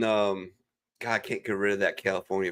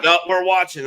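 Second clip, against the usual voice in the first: insistent cousin; 9 semitones higher, 6 dB louder than usual. Retold or imitated. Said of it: "And they're like".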